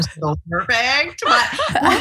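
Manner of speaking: high, giggly self-mockery; laughing at herself. Real, not acted. Joking about being perfect to the hosts, laughing high. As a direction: laugh through a joke on yourself.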